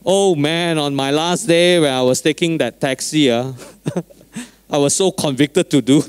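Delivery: normal talking voice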